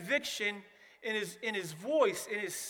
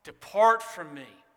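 loud, lower, stern command; yelling; 4 semitones lower, 9 dB louder than usual. Retold or imitated. Jesus cutting off the excuses, loud stern yell. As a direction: bellow it as a final judgment. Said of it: "Jesus yells".

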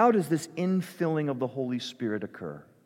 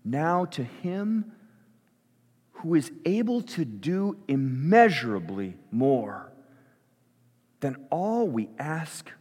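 slow, deliberate prayer with long pauses; slow spoken prayer; slower than usual. Real, not acted. Pastor praying Paul's words over the church, slow prayer. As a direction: pray it slowly, leaning on the key words. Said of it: "I'm gonna say it slowly".

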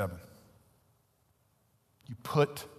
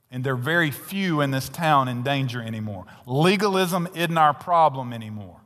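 preaching at full force; 6 dB louder than usual. Acted. Preacher rebuking the church about its real problem, loud sermon. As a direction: press hard on the congregation.

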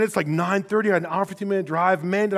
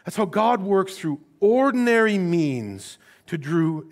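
ordinary talk, nothing marked

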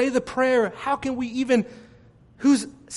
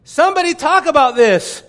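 exasperated plea; 7 semitones higher, 6 dB louder than usual. Retold or imitated. Frustrated plea retold.

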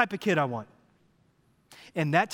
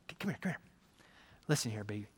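put-on soft, low coaxing to a child; coaxing softly; 8 semitones lower, 12 dB quieter, much faster than usual. Dad quietly coaxing little daughter before the throw. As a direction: hush and coax her close.

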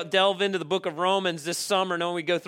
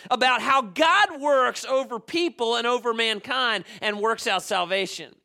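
raised, emphatic preaching voice, higher and driving; fervent preaching; 5 semitones higher, 3 dB louder than usual. Acted. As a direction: preach it to the congregation with full conviction. Preaching at full force.

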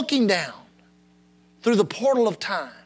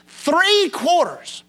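"Three quarters" slow, THREE higher and drawn out; stress on THREE; 6 semitones higher, much slower than usual. Real, not acted. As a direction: hit "Three" hard and slow. Repeats fraction for emphasis.

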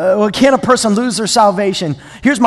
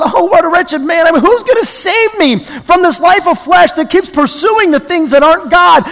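higher-pitched, impassioned mock cry for rescue; 9 semitones higher, 7 dB louder than usual. Preacher voicing Paul's wretched plea to be saved, crying out. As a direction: call out as if desperate to be saved. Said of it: "He even cries out".

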